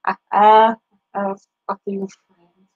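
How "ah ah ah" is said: ordinary delivery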